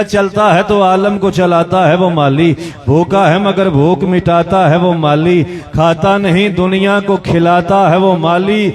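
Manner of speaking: slow, lifted poetic recitation; recited verse. Acted. Preacher recites the gardener couplets to the gathering, slow and lifted. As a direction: declaim each line slowly, building awe.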